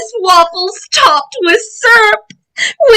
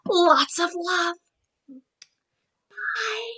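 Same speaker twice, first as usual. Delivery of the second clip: whispering; 12 dB quieter, much slower than usual. Real, not acted. Whispered goodbye.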